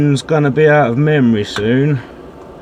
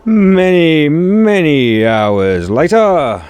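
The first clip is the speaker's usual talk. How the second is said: dramatic slow drawl; 3 semitones higher, 6 dB louder, much slower than usual. Acted. Dramatic time-skip narration.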